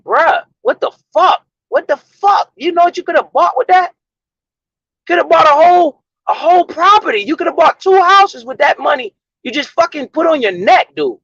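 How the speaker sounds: high, incredulous mock rant; exasperated rant at a flashy spender. Talking to a rich dude wasting money on chains, exasperated high rant. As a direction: voice jumping way up in disbelief. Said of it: "I'm like".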